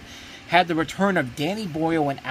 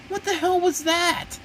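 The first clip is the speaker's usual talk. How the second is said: much higher and slow, stunned; stunned disbelief; slower than usual. Retold or imitated. Reviewer's first thought leaving the film, high and stunned. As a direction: drag it out like you can't believe what you just saw. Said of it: "just like".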